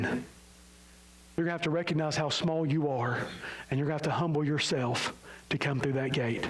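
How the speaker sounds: usual speaking voice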